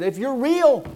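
loud, high defiant yell at God; a young agnostic yelling a challenge at God; retold yell. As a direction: shout it up at the sky, fist shaking. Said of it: "I yelled and I said".

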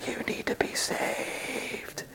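very soft whisper, slowing right down; mock secret whisper. Pastor pretending to speak through pew speakers, playful whisper. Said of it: "And I whisper to you".

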